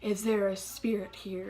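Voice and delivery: slow, eerie call to the spirits; spooky séance voice. Retold or imitated. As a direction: ask the room slowly like a medium. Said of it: "whatever things you'd say and be like".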